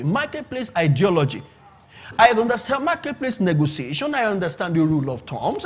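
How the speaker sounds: usual speaking voice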